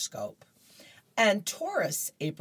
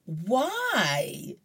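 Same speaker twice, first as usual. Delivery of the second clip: higher, exasperated why; an owner at his wits' end; puzzled John; 6 semitones higher, 4 dB louder than usual. Acted. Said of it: "John says in the final frame".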